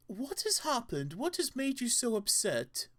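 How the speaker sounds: doting concern. Acted. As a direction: coo as if soothing a small creature. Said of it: "his voice and demeanor suddenly changed".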